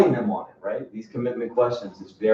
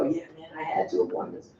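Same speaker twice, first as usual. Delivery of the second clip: barely voiced, softer mumble; whispered, sheepish excuse; 3 semitones higher, 13 dB quieter than usual. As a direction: say it under your breath, hoping nobody notices. Agent reporting to boss.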